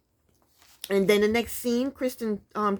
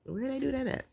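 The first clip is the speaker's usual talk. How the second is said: higher, softer put-on slang; imitated slang catchphrase; 5 semitones higher, 8 dB quieter than usual. Vlogger who doesn't talk like that, soft high slang imitation. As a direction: quietly mimic a phrase that isn't yours. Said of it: "I don't talk like that".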